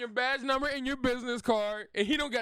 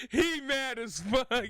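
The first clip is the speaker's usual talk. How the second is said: laughing while talking; giggling through the words; 2 semitones lower, slower than usual. Real, not acted. Streamer laughing at angry man.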